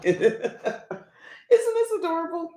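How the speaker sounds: laughing, with the voice jumping way up on adorable; cute squeal. Real, not acted. Vlogger gushing over her cute gadget, laughing high squeal. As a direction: squeal it like you're holding something tiny and cute.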